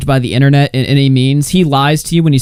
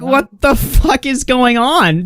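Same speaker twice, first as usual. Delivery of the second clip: high, slow, baffled disbelief; can't-believe-this voice; 10 semitones higher, slower than usual. Retold or imitated. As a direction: drag it out in stunned confusion. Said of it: "I'm just like".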